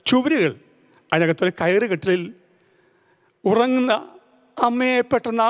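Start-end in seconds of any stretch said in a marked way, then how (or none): none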